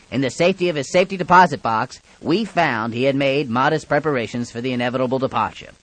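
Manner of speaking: ordinary talk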